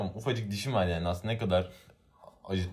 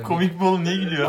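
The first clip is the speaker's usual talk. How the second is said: much higher and louder, incredulous; indignant outburst; 11 semitones higher, 11 dB louder, faster than usual. Real, not acted. Friend indignant that the teacher laughed, shouting. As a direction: blurt it out in mock outrage.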